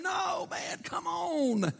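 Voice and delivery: high pleading shout; preaching shout. Real, not acted. Preacher urging the church to let it go, shouting. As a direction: cry out to the church, come on.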